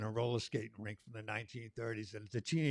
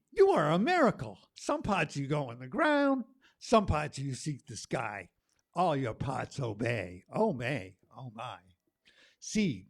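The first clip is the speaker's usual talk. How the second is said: spoken word poem; 3 semitones higher, 8 dB louder, slower than usual. Acted. Poet reading a spring poem to the open mic crowd, declaiming loudly. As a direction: perform the poem like calling the earth awake.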